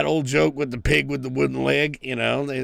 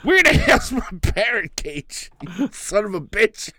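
pirate father; 7 semitones higher than usual. Pretend. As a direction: snarl like a pirate dad at the door. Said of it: "father answers the door with a hook".

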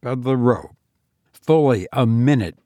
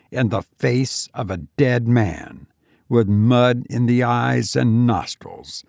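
ordinary delivery, nothing marked

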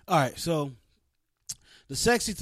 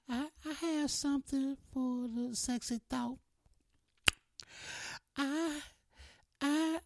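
Niana Renee impression; 12 semitones higher than usual. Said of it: "That's my Niana Renee impression".

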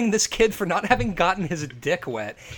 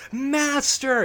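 witches hailing Satan; 8 semitones higher than usual. Acted. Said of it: "and they're like".

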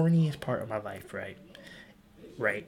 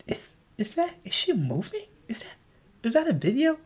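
amazed discovery; 10 semitones higher than usual. Retold or imitated. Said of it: "I was like".

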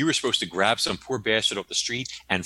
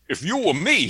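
mock wise guy; 13 semitones higher, 6 dB louder than usual.

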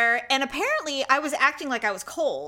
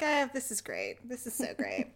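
very soft, pathetic little whimper; mock miserable approval; 3 semitones lower, 11 dB quieter than usual. Woman recalling her humiliation, quiet mock whimper. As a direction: trail it out softly, feigning delight in misery. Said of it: "I'm just like".

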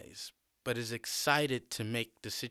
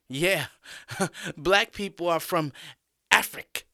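higher, loud, chuckling schoolboy; male student; 6 semitones higher, 4 dB louder than usual. A narrator's reading. Male student backs up his classmate's objection, laughing and pitched up. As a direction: chime in with a snicker, pitched up. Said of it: "Another random male student speaks".

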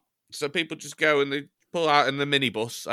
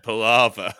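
mock emphatic; 2 semitones lower, 8 dB louder than usual. Shown as a demonstration. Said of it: "almost as insensitive a word".

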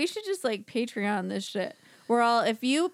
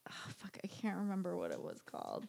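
quick whisper to herself, mid-story; whispered aside; 16 dB quieter than usual. Real, not acted. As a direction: whisper it under your breath, searching for a name. Whispering aside, can't recall name.

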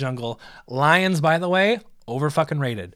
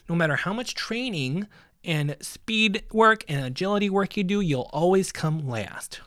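slow, drawn-out mock lecture; deliberate, part-whispered discouragement; patronizing advisor; 3 semitones higher than usual. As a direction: spell out the hopeless truth slowly. Same advisor lecturing Chris on losing.

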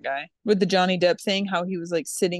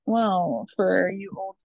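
ordinary talk, nothing marked

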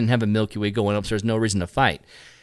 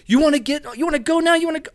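mock fight challenge; 13 semitones higher, faster than usual. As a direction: imitate a guy squaring up for a fight. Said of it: "getting face to face".

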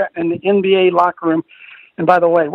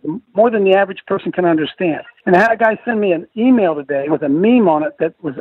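normal talking voice

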